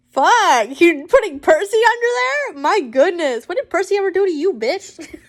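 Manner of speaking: mock-furious rant for a character; outraged aside. Real, not acted. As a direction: protest at the letter writer.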